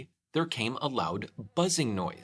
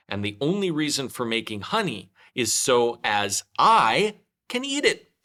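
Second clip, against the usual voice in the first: Pooh, rising to glee; 2 semitones higher, 7 dB louder than usual. Acted. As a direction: build up to the happy point. Pooh reaching his conclusion.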